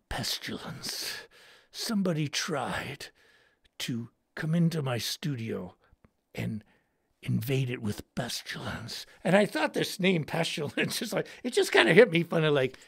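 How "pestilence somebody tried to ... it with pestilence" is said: hushed horror-story tone; ominous whisper. Pretend. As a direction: breathe the word like a curse. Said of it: "hushed ominous storytelling".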